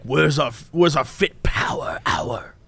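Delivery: low, hushed mock plea from the body; half-whispered body voice; complaining body. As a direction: play a needy body asking, low and whispery. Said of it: "Maybe your body's like".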